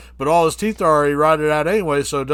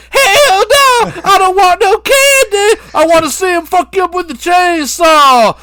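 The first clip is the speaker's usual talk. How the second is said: [name] scream; 11 dB louder than usual. Acted. [name] turning down candy from Coral, screaming. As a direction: scream the refusal, hammed up.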